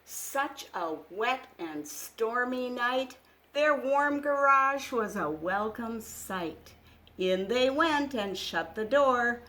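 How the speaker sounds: picture book reading. Acted. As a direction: read it like a bedtime storybook. Reads picture book aloud.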